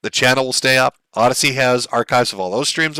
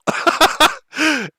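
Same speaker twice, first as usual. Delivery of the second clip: high-pitched burst of laughter; amused laugh; 13 semitones higher than usual. Real, not acted. Streamer reacting to a viewer's joke, high amused laugh. Laughs at pretty girl line.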